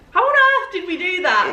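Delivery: laughing. Retold or imitated. Laughing at own mistake.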